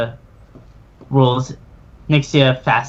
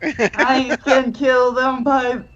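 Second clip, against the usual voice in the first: defiant retort; 8 semitones higher than usual. Acted. Fleeing girl answering the villain, high and defiant. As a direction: brush off the threat.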